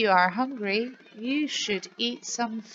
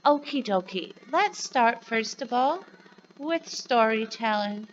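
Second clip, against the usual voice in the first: ordinary delivery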